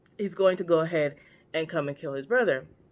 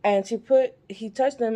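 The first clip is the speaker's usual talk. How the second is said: ordinary talk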